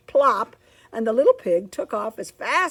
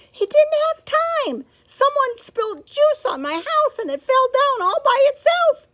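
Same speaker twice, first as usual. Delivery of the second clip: high pig voice; 8 dB louder than usual. Acted. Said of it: "said the first pig".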